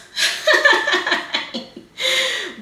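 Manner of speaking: high, loud burst of laughter. Real, not acted. Reader amused by her own "aggressive" remark, laughing.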